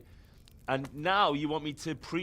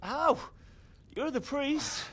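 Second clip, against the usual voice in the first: high-pitched, baffled objection; incredulous; 11 semitones higher, slower than usual. Acted. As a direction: throw it back in disbelief. Henry balks at giving help.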